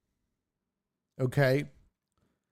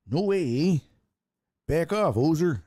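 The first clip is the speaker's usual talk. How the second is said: mock Canadian accent, louder; Canadian hoser voice; 3 semitones higher, 3 dB louder than usual. Host quoting the movie Strange Brew, in a hammed-up Canadian accent. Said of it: "Strange Brew".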